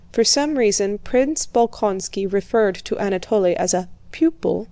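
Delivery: normal talking voice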